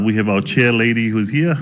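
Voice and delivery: ordinary talk, nothing marked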